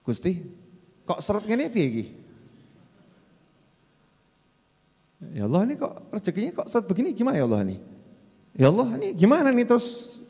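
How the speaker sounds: lightly voiced worried whine to God; mock anxious lament, fast at the end; fretful complaining prayer. Worshipper complaining to God about scarce income, mild fretful whine. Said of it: "ini tambah komentar kok".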